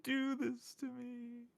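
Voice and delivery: high, slow pretend sob; mock heartbreak. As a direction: plead in a drawn-out, high whine. Mock distress at stolen blankie.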